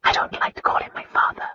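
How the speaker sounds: hushed breathy whisper. A narrator's reading. Wife whispering about her hated father. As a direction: barely voice it, ashamed. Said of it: "Christina whispered".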